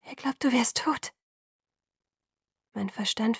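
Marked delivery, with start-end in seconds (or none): 0.0-1.1 hushed, higher, strangled voice; choked whisper. A narrator's reading. Alice telling Bella what Edward believes, choked whisper. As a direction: whisper the awful news, stifled. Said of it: "nur ein ersticktes Flüstern".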